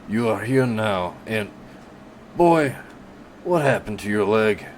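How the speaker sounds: put-on gruff voice rising high on boy; surprised gruff butcher. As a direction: catch sight of the wound. Casper notices the leg.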